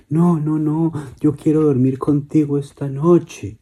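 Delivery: pleading. Acted. Girls begging dad to sleep with him, pleading. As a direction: beg to stay with papá.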